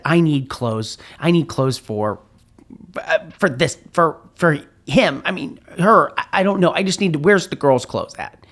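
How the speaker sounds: embarrassed and rushing; flustered. Retold or imitated.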